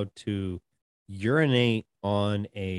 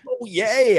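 mock excitement; 12 semitones higher, 7 dB louder than usual. Mock contestant cheer.

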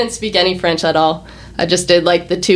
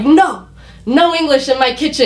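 imitating the chef's ban, pitched higher; a mock strict chef laying down the rule; stern chef; 7 semitones higher than usual. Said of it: "the chef turns and he was like".

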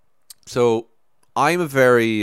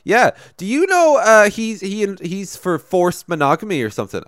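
coworker's gotcha; 11 semitones higher, 6 dB louder than usual. Retold or imitated. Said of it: "then he was like".

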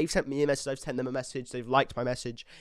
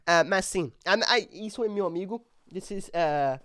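ordinary delivery

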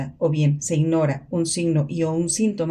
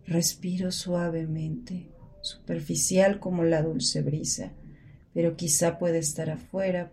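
drowsy murmur; 6 dB quieter than usual. Acted. Woman fighting to get out of bed, drowsy and soft. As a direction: let each word sink toward sleep. Sleepy inner voice of depression.

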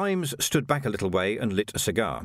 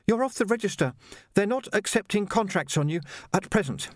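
put-on much higher, polite young Jocasta, a touch breathless; young woman voiced; 9 semitones higher than usual. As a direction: play a well-mannered girl treading water. Jocasta explains.

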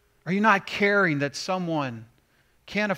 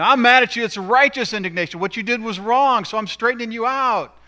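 loud, higher, huffy mock outburst of someone mad and calling it righteous; mock self-righteous anger; 7 semitones higher, 10 dB louder than usual. Churchgoer scolding someone, mocking fake righteous anger. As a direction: ham up a petty tirade, much louder.

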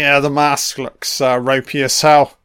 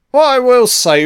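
emphatic raised voice; 9 semitones higher, 5 dB louder than usual. Real, not acted. Sudden emphatic opener.

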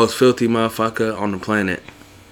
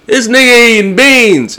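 loud mocking shout across a theater; yelling the quote; 14 semitones higher, 8 dB louder than usual. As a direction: yell it like a heckler in the seats. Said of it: "I can see that being yelled at a movie".

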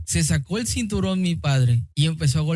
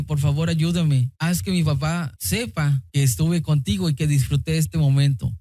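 ordinary delivery

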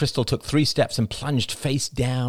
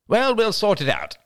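brisk higher-pitched cut-in; abrupt; 13 semitones higher, 3 dB louder than usual. A narrator's reading. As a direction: close the matter abruptly. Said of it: "said Crystal abruptly".

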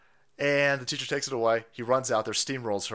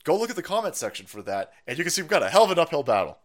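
usual speaking voice

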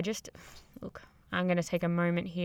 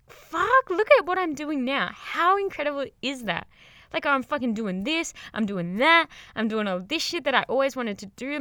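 a thrilled shout to yourself, then settling; excited self-talk; 5 semitones higher than usual. Pretend. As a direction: cheer yourself on like you can't believe your luck. Imagined proud self-talk.

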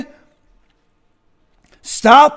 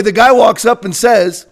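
usual speaking voice

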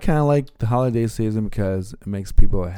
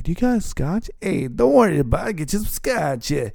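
put-on old patient voice; 10 semitones higher than usual. Old patients voiced offering Scotch.

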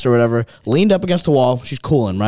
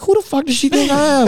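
higher, louder outraged aside; high, louder disbelief at her nerve; mock indignant; 4 dB louder than usual. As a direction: act offended to your friend. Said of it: "I was like".

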